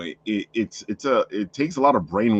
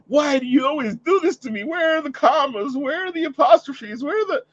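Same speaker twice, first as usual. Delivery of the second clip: high, loud whiny complaint about missing commas; hammed-up shrill friend voice, much higher; mock exasperated whine; 4 dB louder than usual. Writer quoting a friend complaining about punctuation, high mock whine.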